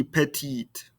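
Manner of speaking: "petite" ending on the T, no E; silent final E. Shown as a demonstration. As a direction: stop "petite" right after its T. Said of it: "I don't hear this E".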